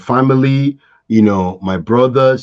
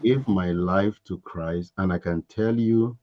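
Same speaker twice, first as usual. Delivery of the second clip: sincere; 5 semitones lower, 9 dB quieter than usual. Real, not acted. Soft sincere testimony.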